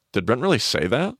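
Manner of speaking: incredulous question leaning on "say"; stress on SAY. Shown as a demonstration. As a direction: hit "say" with a rising, doubting swing. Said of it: "the emphasis, if I say".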